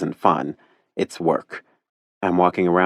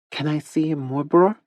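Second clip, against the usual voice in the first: put-on cute little girl; 9 semitones higher than usual. A narrator's reading. Caro asks Teddy about massages in a cute little-girl voice. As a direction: ask like a curious small child.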